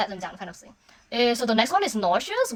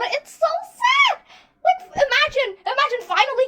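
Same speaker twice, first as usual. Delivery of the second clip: anguished shout; 14 semitones higher, 3 dB louder than usual. Real, not acted. Fan breaking down over the lyrics, high anguished shout. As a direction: cry it to the ceiling. Overwhelmed by sad song.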